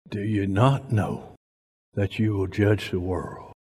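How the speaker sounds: soft, low rereading of the verse; quiet repeat. Acted. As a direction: let it sink in softly. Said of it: "repeated quietly".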